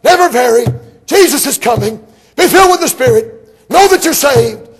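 high, loud, hammering revival preacher; preacher rising to full shouting fervor; fiery old-time preaching. Retold or imitated. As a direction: shout it like a hellfire preacher from the pulpit.

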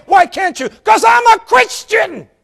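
high, slow, shouted declaration; triumphant shout. Retold or imitated. Widow shouting her answer at the pastor, high and slow. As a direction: belt the answer out.

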